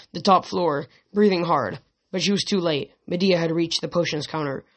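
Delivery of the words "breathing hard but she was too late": normal talking voice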